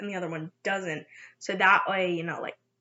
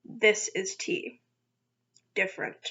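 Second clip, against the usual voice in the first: slow; 3 semitones lower, 7 dB quieter than usual. Shown as a demonstration. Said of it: "not the same as M".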